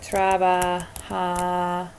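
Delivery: trabaja stretched out slowly; slow demo. Shown as a demonstration. Teacher building a verb for students, slow. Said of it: "What are we gonna do with that?".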